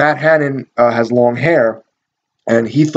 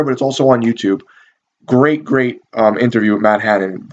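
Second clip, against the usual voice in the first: ordinary talk, nothing marked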